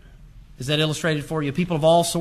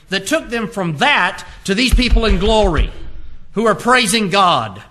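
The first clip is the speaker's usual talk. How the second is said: raised, insistent preacher's voice; urgent pulpit proclamation; forceful preaching; 4 semitones higher, 5 dB louder than usual. Acted. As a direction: drive through the verses, pitched high and strong. Preaches at raised force, stringing verses.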